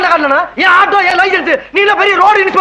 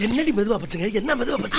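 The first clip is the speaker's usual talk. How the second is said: much softer hushed scolding; hushed argument; 6 semitones lower, 12 dB quieter than usual. Acted. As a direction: argue under your breath. Mocking wife's request, very softly. (மாமனாருக்குக் கேட்காமல் மனைவியைத் திட்டுதல், தாழ்ந்த குரல்)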